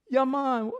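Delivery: high-pitched attempt at a Hawaiian accent; botched Hawaiian accent. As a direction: imitate a Hawaiian local, badly. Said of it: "can't even do the Hawaiian accent".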